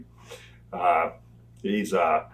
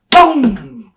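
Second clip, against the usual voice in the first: a high, loud mock crash noise; imitated boom; 11 semitones higher, 10 dB louder than usual. Storyteller imitating the crash he heard from the side room, high loud boom. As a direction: make the boom of a body hitting the floor.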